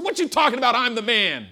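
loud, high swagger; boastful David; cocky king. Acted. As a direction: brush off the prophet proudly.

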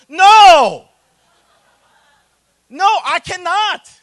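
shouted high no, then slow and firm; emphatic refusal. Acted. Pastor refusing the pleading congregant, shouted and slow. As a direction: shut the request down flat out.

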